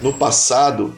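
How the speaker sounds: normal talking voice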